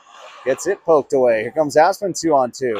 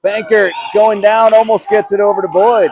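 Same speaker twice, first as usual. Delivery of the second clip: raised, loud play-by-play burst; excited call; 7 semitones higher, 6 dB louder than usual. Real, not acted. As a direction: call the near chance with a surge. Live call of a near chance.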